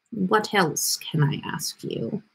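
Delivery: normal talking voice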